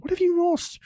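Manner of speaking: high, loud call. Acted. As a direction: call across the dark. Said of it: "Bilbo called".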